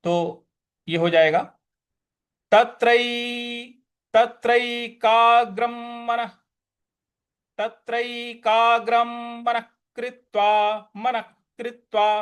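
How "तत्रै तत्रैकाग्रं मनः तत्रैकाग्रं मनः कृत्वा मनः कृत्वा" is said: तत्रैकाग्रं मनः कृत्वा with m then kh; m and kh sounds. Shown as a demonstration. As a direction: say ग्रम्मनः and ख कृत्वा. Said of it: "ख का उच्चारण लाएंगे".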